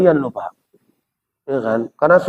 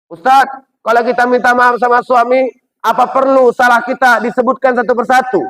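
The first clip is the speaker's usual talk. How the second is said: preacher voices a wife asking whether to list her faults, higher pitched; questioning wife; 8 semitones higher than usual. Retold or imitated. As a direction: voice the woman asking earnestly. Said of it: "Ada lagi yang nanya".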